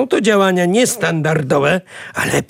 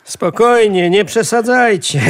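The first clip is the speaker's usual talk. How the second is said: higher, louder protest; dismissive reassurance; 5 semitones higher, 4 dB louder than usual. Acted. Uncle tells frightened family not to overreact, dismissive reassurance. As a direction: brush their worry off emphatically. Uncle brushes off their panic.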